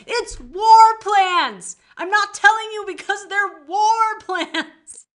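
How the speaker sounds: slow, high mocking brush-off; lightly voiced stubborn boyfriend; dismissive boyfriend. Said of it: "the Xaden's just like".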